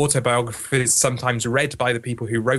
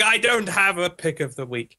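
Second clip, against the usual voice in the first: higher-pitched imitation of a panicked email; mock panic; 6 semitones higher than usual. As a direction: imitate someone in a mild panic. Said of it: "sent me a panicked email going".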